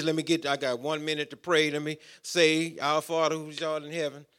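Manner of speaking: fast, soft mock of a rushed religious prayer; mock hurried prayer. Preacher mocking a rushed one-minute prayer, quick and dismissive. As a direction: rattle it off like a chore. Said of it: "not just some religious".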